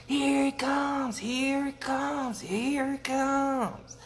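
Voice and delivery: high, long-drawn bullfrog holler; big slow frog chorus cry; bullfrog call. A narrator's reading. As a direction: stretch each word out like a croaking alarm. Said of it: "he could hear the bullfrog start up".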